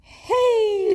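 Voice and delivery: put-on loud, long baby squeal, way up high; really high pitch. Baby greeting her on waking, really high pitch. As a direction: imitate a baby's piercing hello. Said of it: "really high pitch like that".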